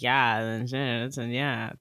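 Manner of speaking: low, slow deadpan drone; deadpan monotone. Retold or imitated. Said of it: "she's very monotone and just like".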